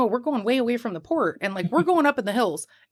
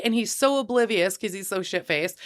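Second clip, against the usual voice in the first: ordinary talk, nothing marked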